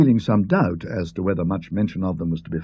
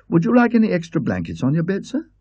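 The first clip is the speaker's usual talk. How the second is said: put-on lightly raised, eager young maid; young maid asking the guest politely; 8 semitones higher than usual. Maid voiced by the narrator.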